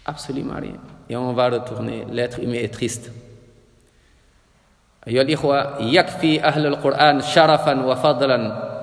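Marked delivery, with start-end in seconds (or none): none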